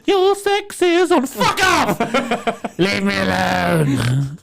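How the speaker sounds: high laughing mock shriek; squealing brush-off. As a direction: shriek her away, giggling.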